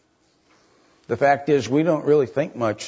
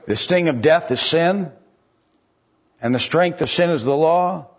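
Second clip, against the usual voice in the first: preaching at full force; 5 semitones higher than usual. Acted. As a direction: lift the congregation toward the victory.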